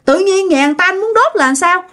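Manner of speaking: high-pitched scandalised remonstrance; acted indignant grandmother; protesting to stop him. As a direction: argue him out of it urgently. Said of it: "Cái bà ngoại mới cản".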